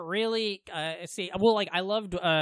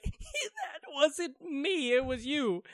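high, softer, slow mock laughing through the words; imitated laughing fit; 8 semitones higher, 4 dB quieter, much slower than usual. Retelling Sandler struggling not to laugh, mock laughing. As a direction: quote him as if cracking up. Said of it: "Adam Sandler's laughing so hard, and he's like".

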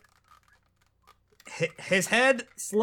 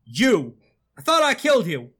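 startled, accusing exclamation; shocked confrontation; 3 semitones higher, 6 dB louder than usual. Acted. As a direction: confront a foe you thought was dead. Character confronts supposedly slain foe.

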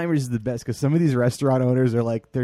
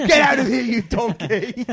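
furious chef; 10 semitones higher, 7 dB louder than usual. Retold or imitated. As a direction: yell it at the cook. Gordon Ramsay voice continued.

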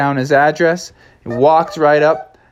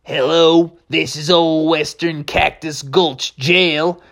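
slow, high western drawl; stretched western switchboard drawl; same western drawl; 4 semitones higher, slower than usual. Acted. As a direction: answer the jail phone in a western drawl. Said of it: "everybody in that town talk like you".

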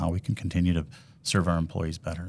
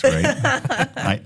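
normal talking voice

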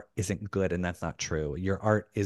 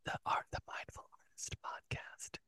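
very soft ASMR-style whisper; breathy whisper; 20 dB quieter than usual. Shown as a demonstration. Host whispers the podcast song's title, very breathy. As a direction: whisper the show's name, all breath. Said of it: "It's very breathy".

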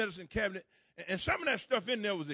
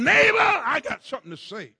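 call to neighbor; 5 semitones higher, 9 dB louder than usual. Shown as a demonstration. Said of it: "Look at your neighbor and tell him".

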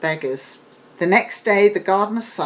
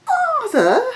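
put-on high, bright young princess voice; a daughter pleading with her father; high girlish princess; 5 dB louder than usual. A narrator's reading. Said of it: "replied the princess".

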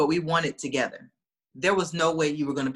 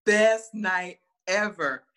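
ordinary talk, nothing marked

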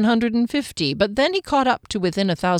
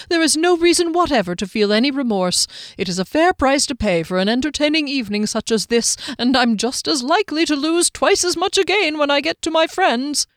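higher, genial rambling excuse; tipsy halfling; 4 semitones higher than usual. Acted. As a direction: smoothly talk your way out. Tipsy Hector excusing himself.

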